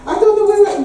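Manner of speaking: high, loud Jamaican mother's complaint; Jamaican accent. Retold or imitated. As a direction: imitate a Jamaican mum lamenting aloud. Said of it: "that wonderful quintessential Jamaican accent".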